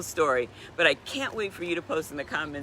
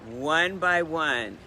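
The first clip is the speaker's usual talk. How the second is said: slow dramatic; much slower than usual. Retold or imitated. People drifting away, dramatic pause.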